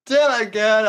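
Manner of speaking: usual speaking voice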